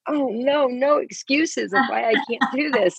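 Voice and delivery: high mock dismay; dismayed reaction. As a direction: pitch up in playful defeat. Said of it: "I'm like".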